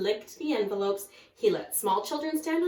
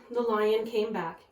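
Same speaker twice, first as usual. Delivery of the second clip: plain reading voice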